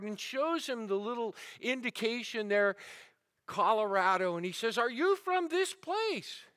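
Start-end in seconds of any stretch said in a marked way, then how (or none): 4.8-6.5 eager question. Retold or imitated. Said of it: "speaking in the King's English".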